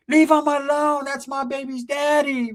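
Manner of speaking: high, slow put-on girl's voice; mock shrieking girlfriend. Girlfriend defending her baby's daddy, high put-on voice. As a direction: plead shrilly for her man. Girlfriend screaming while slapping him.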